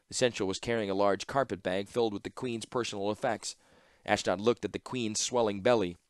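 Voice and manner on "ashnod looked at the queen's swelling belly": plain reading voice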